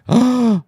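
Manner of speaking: loud, high gasp; big sharp intake of breath; shocked gasp. Real, not acted. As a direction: gasp in sudden dismay. Realizes music was muted all along.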